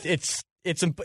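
usual speaking voice

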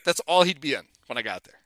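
usual speaking voice

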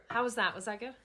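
ordinary talk, nothing marked

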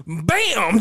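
loud, high "bam"; imitated impact, punched hard; mock stomp noise. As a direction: slam it out like a heavy stomp. Acting out a foot stomp.

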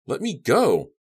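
high, loud demand for release; frantic high cry; desperate yell. A narrator's reading. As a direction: shout it, voice leaping up. Captive yells at captor.

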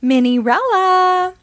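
high, loud, slow sing-song call. A narrator's reading. Fairy godmother calling Minnie awake, drawn-out call. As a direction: call her name across the room. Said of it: "a voice calls".

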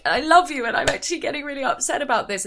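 mock drunk friend. Mimicking a drunk teen at a party, fast and gushy. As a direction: babble it like a tearful drunk. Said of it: "that kind of drunk".

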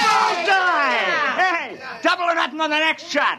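put-on a drunk crewman whoops after his bottle smashes a Martian window; gleeful drunken shout. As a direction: crow over the hit, then egg him on.

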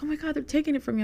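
imitating worked-up fans. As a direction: gush like a panicked fan. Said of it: "A lot of people always tell me".

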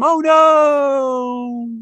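very high, loud drawn-out wail; mock falling wail. Podcaster acting Scooby plummeting into the chasm, hammed-up high wail. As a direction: cry out as if plummeting away.

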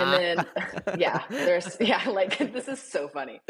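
real giggles, pitch lifted, words broken up; laughing while talking. Real, not acted. Friend laughs along at the awkward run-in with mom, laughing. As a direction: struggle to talk through your own laughter. Both crack up at the story.

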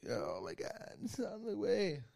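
retold call, squeezed high and soft; mock phone-call panic. Partner phones him with the news, mock panic. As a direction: act out a caller's drawn-out news.

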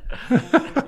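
the host bursts out laughing; high burst of laughter. Real, not acted.

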